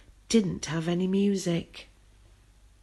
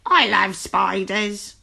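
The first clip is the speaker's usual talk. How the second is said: cried out; 4 dB louder, slower than usual. A narrator's reading. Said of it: "cried the friendly witch".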